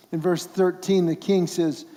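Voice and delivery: ordinary talk, nothing marked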